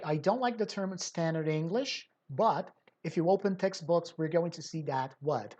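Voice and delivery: usual speaking voice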